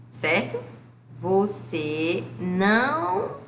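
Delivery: ordinary talk, nothing marked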